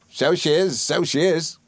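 high, delighted rustic exclamation; crying out as Ham. A narrator's reading. Ham agreeing about Emily, high excited cry. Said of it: "cried Ham".

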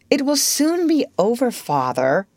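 put-on high-pitched cheery boy. A narrator's reading. John reassuring his dreading father, cheerily. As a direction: offer a lilting bit of comfort. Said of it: "John said cheerily".

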